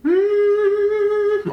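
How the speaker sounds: high, loud hum of pleasure; delighted mmm. Real, not acted. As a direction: savour the first bite aloud. First bite of mushroom.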